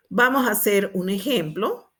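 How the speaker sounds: ordinary delivery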